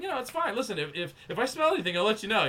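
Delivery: high-pitched retold denials; protesting. Nervous son assuring his father there's no fire, protesting. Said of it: "I'm protesting".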